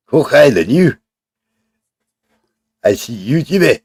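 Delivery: lightly put-on Scottish accent; a brief amateur stab at Scottish; mock Scottish accent. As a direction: throw out jokey stock Scottish phrases. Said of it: "That's all the accent you're getting out of me".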